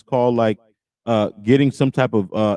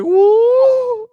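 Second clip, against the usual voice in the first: mock siren-like woo, much higher and louder; Kendra G's whoop; 5 dB louder than usual. Host mimicking Kendra G's whoop, high mock siren woo. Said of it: "Kendra G sounded like a fucking siren".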